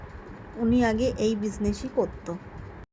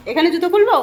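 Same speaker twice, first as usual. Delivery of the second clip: high, loud eager question; excited question; 8 semitones higher, 12 dB louder, faster than usual. Real, not acted. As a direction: call out the question brightly. Arriving, asks loudly about shoes.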